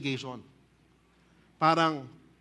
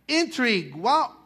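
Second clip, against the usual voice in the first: ordinary delivery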